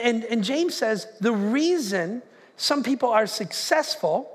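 ordinary talk

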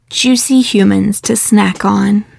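ordinary delivery, nothing marked

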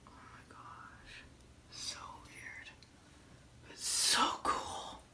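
very soft unvoiced whisper; hushed whisper. Real, not acted. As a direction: barely breathe the words. Whispering under face mask.

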